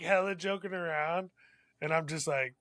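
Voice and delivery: normal talking voice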